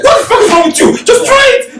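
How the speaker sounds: very high-pitched indignant squeal; mock outrage. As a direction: imitate exasperated friends, pitch shooting right up. Acting out friends' indignant urging.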